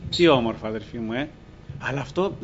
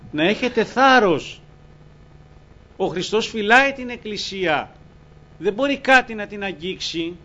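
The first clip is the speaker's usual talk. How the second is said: higher, louder preaching, urging courage; fervent preaching; 7 semitones higher, 5 dB louder than usual. Real, not acted. Preacher urging the congregation to take courage, fervent.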